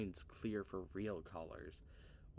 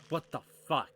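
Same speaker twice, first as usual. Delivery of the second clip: high, loud shocked outburst; jolted by a sudden scare; real jump-scare yelp; startled cry; 6 semitones higher, 9 dB louder than usual. Real, not acted.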